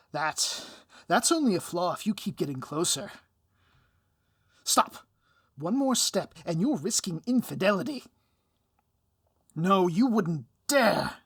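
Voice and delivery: loud, high, hammy villain panic; backing away in mock alarm; panicked villain. Villain panics as the hero keeps stepping closer, loud and hammed-up.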